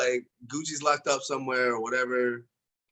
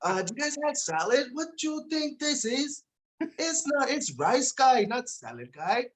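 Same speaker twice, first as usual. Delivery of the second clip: Uncle Roger; 10 semitones higher than usual. Acted.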